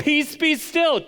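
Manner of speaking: high, loud, drawn-out command; Jesus as sea whisperer. Acted. Preacher acting Jesus commanding the sea, comic forceful shout. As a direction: shout it like a trainer ordering a dog. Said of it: "he's like".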